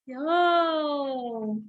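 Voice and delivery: ordinary delivery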